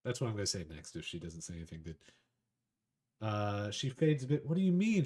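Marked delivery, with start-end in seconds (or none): 4.5-5.0 high, curious catgirl question; Amelia asking. Acted. Catgirl Amelia asking the player, higher and curious. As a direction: perk up and ask, voice lifted higher. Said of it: "she asks, her ears perking up".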